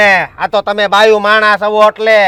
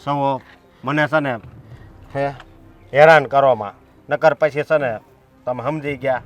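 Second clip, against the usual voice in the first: low menacing threat; 8 semitones lower, 7 dB quieter than usual. Acted. Brother warns the women in a low, menacing voice. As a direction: lower and much softer, menacing.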